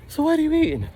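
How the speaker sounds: very high squeaky imitation of her pestering; high mocking girlfriend voice. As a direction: pitch way up as the nagging girlfriend. Mimicking girlfriend.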